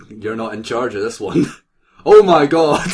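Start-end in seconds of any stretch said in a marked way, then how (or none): 2.0-2.9 loud high cry of shock; real laughing outburst of disbelief; shocked shriek. Real, not acted. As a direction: yelp in delight at the picture. Shocked by the picture.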